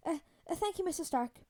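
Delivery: put-on timid, grateful kid; relieved, hesitant boy. A narrator's reading. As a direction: stammer out thanks in a kid's voice. Relieved boy thanks his mentor.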